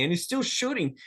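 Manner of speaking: ordinary delivery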